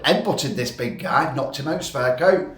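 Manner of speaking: ordinary talk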